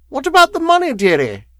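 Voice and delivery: very high, loud crone wheeze; wheezing. A narrator's reading. Woman asking the lawyer for her money, wheezing. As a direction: wheeze it greedily. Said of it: "she wheezed".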